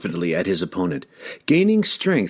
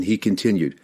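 ordinary delivery, nothing marked

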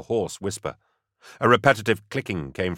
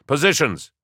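high, loud barked order; yelled order; 7 semitones higher, 4 dB louder than usual. A narrator's reading. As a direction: bark the command. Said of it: "Gaunt yelled".

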